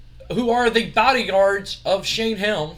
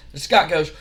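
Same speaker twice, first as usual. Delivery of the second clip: normal talking voice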